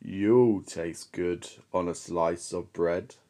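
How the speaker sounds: low, slow monster voice; hungry Gruffalo. Acted. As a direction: savour each word like a meal. Gruffalo threatens mouse.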